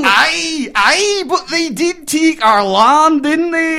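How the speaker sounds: high, loud mock Scottish accent. Puppet Scot reminds Wallace of the land, high loud Scottish accent. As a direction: press the point in a hammed-up Scottish accent. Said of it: "we'll have them do a little scene".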